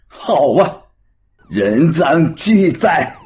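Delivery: slow, drawn-out triumphant shout; gloating shout. Acted. Bald man to the woman he reported, gloating shout. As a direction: crow over catching her red-handed. Catches her handing out booklets.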